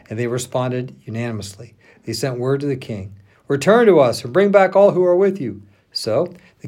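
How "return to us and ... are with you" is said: loud, high-pitched summons; rousing call. A narrator's reading. Men of Judah call the king home, loud summons. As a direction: call them back loudly, pitch raised.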